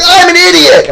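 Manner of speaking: high hammed-up holler; gleeful battle yell. Washington answering the French, high hammed-up yell. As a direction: holler it like a mock war cry.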